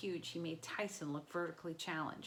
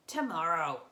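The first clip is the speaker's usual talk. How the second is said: booming monster; 8 dB louder than usual. Acted. Monster insisting.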